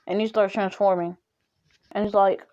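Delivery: ordinary delivery, nothing marked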